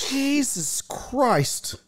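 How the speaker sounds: mock shocked sister. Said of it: "Laura goes".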